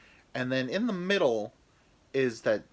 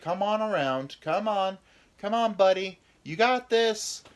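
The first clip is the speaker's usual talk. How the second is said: high, loud coach cheering him on; penis as third base coach; 9 semitones higher, 5 dB louder than usual. Acted. His penis as base coach urging him home, loud and high. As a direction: hype him around the bases like a Little League coach. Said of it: "Third base coach is my penis".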